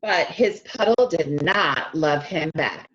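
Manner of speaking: put-on lively storybook reading for young kids; read-aloud narration. A narrator's reading. Teacher reading a picture book to her class, animated read-aloud. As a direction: read it like circle time, bright and inviting.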